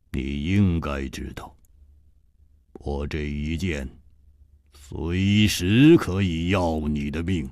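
低沉冰冷的反派嗓音，慢慢吐字; 压低声音，冷冰冰地威胁; 冷冷的黑袍客. A narrator's reading. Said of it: "黑袍客冷冷地说". (黑袍客对楚留香冷冷威胁，低沉阴冷)

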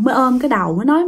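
plain reading voice

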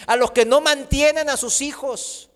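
usual speaking voice

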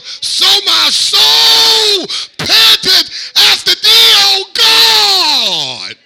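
very high, loud, drawn-out preacher's shout; preaching at full force. Acted. Preacher crying out the deer-and-water-brook psalm, full-force slow shout. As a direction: stretch every word out and shout it to heaven.

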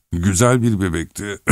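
acted elderly rambling, broken by coughing; coughing old husband. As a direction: drag the words out between coughing fits.

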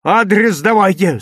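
put-on tense, insistent boy; through clenched teeth. A narrator's reading. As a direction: demand it with gritted teeth. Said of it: "сжимая зубы".

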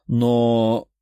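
drawn-out, level, unfinished word; hesitating on a held note; hesitant. A narrator's reading. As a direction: trail into a thoughtful pause. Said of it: "Девушка задумалась".

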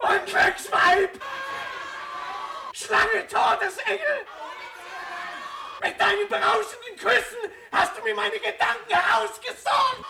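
cursing the woman in rage; furious shout. Acted. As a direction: curse her with fury.

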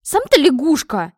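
high, loud comeback; offended outburst; indignant retort. A narrator's reading. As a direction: throw the insult straight back. Princess throws insult back.

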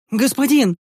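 thin, high shout; a young helper cries out thinly to his lord; Стефий shouting thinly. Acted. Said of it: "выкрикнул тонко Стефий".

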